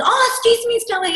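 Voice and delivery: high, sugary mock politeness; sweet other mum. Mum stopping her at school, high sugary politeness.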